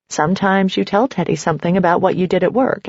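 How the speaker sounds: plain reading voice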